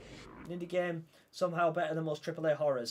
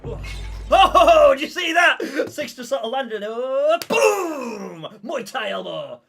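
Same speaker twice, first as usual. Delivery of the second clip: high, loud whooping with laughter; excited shouting; 9 semitones higher, 15 dB louder, slower than usual. Real, not acted. Player crows over Six's landing blow, shouting excitedly. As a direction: exclaim wildly, laughing in amazement. Amazed at a move in game.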